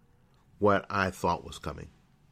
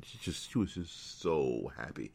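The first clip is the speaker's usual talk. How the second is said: normal talking voice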